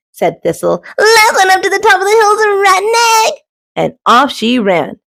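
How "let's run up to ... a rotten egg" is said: fast, high excited challenge; excited dash. Acted. Thistle challenging Duck and Goose, fast and high. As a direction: rattle it off and race.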